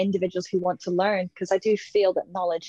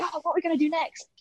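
high, fast and breathy eager puppy; excited dog; 9 semitones higher, 4 dB quieter, faster than usual. Retold or imitated. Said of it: "really happy and excited".

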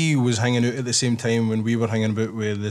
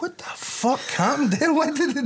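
baffled; 13 semitones higher than usual. Retold or imitated. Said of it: "I think".

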